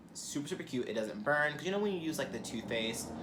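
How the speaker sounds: ordinary talk, nothing marked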